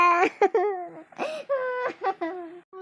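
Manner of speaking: long drawn-out wailing sob; despairing crying moan; loud sobbing. Acted. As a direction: break down bawling. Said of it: "ಅಳ್ಬೇಡಿ".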